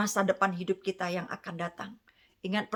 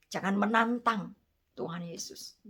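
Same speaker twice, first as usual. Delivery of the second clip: ordinary talk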